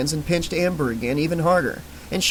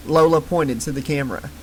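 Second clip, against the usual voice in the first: ordinary delivery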